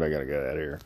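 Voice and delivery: usual speaking voice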